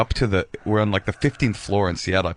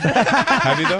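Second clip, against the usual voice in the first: laughing disbelief; 10 semitones higher, 3 dB louder, much slower than usual. Real, not acted. Needling his friend about farting, laughing. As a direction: pitch jumps up, laughing through the question. Pressing again through laughter.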